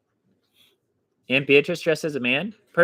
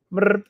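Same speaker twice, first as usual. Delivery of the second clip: higher, louder rolled cat chirp; cat trill; 8 semitones higher, 4 dB louder than usual. Acted.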